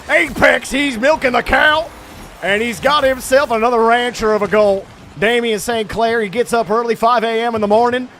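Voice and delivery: excited Southern sportscaster, drawing words out; Southern accent. Acted. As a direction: build into a rousing Southern farm-themed call. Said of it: "broken out into a southern accent".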